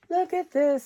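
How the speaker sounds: high delighted exclamation; excited. Real, not acted. Showing her craft scraps to viewers, high delighted exclamation. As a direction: pitch it up with glee.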